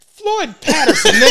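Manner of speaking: loud, high, laughing retort; laughing shout. Retold or imitated. Retelling how he teased Rob, laughing shout.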